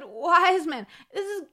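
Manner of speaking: high, loud half-crying complaint; hammed-up sobbing villager; tearful villager. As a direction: wail it like he's at the end of his rope. Mom's lively version, man in tears.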